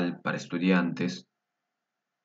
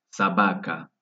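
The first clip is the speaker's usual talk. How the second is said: ordinary talk, nothing marked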